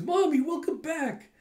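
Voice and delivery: put-on high excited child voice; happy little kid. Young Kokoro welcoming her mother home in a high child voice. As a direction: greet mom like a delighted toddler.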